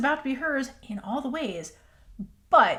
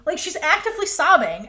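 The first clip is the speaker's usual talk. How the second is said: ordinary talk, nothing marked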